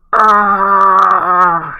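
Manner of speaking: high, loud mock scream; falling scream. Matty falling from his wicker tower, loud high scream. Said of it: "he's going like".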